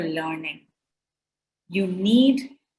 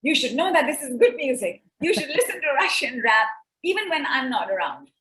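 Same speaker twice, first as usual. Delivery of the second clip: imitating son; 5 semitones higher than usual. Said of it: "And he's like".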